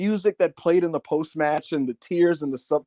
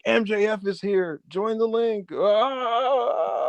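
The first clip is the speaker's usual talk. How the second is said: higher-pitched hype ending in a drawn-out yell; mock excited announcement; 5 semitones higher, much slower than usual. Host tweeting that a guest popped in, mock hype. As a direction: play an overexcited tweet aloud, lightly hammed-up. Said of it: "I would have tweeted".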